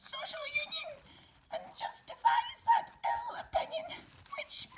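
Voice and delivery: a timid little mouse squeaking out Burns; squeaky mouse. Acted. Mouse puppet recites Burns poem.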